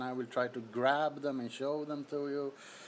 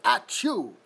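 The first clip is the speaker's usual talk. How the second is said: plain sneeze imitation; 7 dB louder than usual. Shown as a demonstration. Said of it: "do you sound exactly the same, like".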